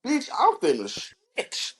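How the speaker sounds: high, sassy mock girl voice. Teasing his friend, squealing as the high girl. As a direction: play her sassy and high-pitched.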